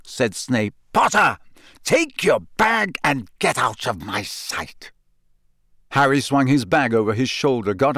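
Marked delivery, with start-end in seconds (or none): 0.9-4.9 angry dismissal. A narrator's reading. Teacher throwing Potter out, angry.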